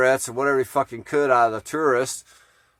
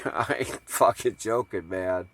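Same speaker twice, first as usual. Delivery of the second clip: ordinary talk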